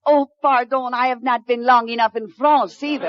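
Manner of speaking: put-on French lady, a bit hammed-up; fake French accent. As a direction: play a fake French mademoiselle with a thick French accent. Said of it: "she has a decided French accent".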